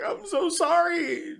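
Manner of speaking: high, drawn-out tearful wail; mock crying. Killer apologizing as he stabs the deer, mock crying. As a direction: imitate someone bawling an apology. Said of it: "crying and shit".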